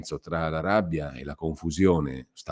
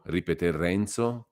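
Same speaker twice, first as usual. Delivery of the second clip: ordinary delivery, nothing marked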